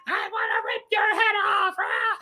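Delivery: high screamed cartoon vampire threat; Gilbert Gottfried's vampire. Reviewer imitating vampire Santa threatening a kid, high screech. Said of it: "hear him doing the vampire noise".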